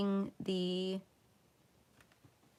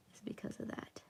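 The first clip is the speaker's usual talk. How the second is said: whispered aside; whispering; 16 dB quieter than usual. Acted. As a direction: breathe it as a secret. Confessional aside.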